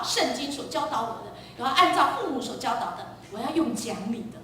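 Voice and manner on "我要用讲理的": normal talking voice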